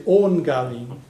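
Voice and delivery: slow, careful "own Galin"; teacher modelling Irish; Irish phrase demo. Shown as a demonstration. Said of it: "if you wanted to say from the girl".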